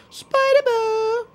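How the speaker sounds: very high, slow drawn-out "Spider ball"; announcer voice. Pretend. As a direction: stretch it out like a game announcer. Announcing the power-up grandly.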